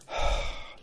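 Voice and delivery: a long, heavy unvoiced sigh. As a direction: sigh out the old man's sorrow. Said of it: "sighing heavily".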